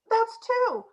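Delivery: high, gleeful mock tally; smug count. Developer gloating over gaming the metric, high smug tally. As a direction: announce the score like a prize.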